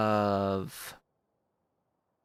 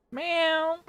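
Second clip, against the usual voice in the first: high, louder imitated cat meow; 5 dB louder than usual. Meowing back at the cat on the bookcase, high mock meow.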